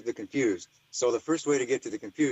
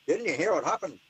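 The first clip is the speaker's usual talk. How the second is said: imitating an Irish man, pitched up, light Irish lilt; 5 semitones higher than usual. A light Irish accent as the lab man answers the phone. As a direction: do a mild Irish accent for the caller. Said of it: "I'm gonna stop doing my Irish".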